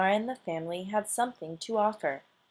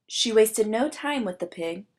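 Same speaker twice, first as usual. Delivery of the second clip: usual speaking voice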